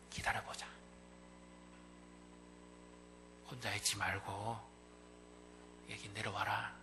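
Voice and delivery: soft, hushed comfort; gentle father. Acted. Father gently calling his child home.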